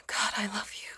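lovestruck, barely voiced; breathy love whisper. Girlfriend confessing her love, whispered.

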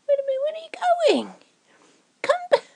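high, loud call after someone leaving; panicked call to come back. Acted. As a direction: shout after him as he walks off. Calling after partner leaving.

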